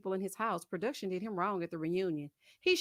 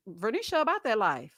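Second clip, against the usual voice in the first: usual speaking voice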